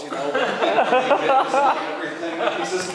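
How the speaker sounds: usual speaking voice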